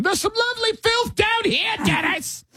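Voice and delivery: very high British peasant voice; mock Monty Python yell. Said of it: "This is a Monty Python nightmare".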